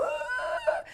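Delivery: put-on very high-pitched little-kid wail; high put-on bawl of a child; mock child's wail. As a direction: imitate a small child crying out. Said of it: "the little kids who go".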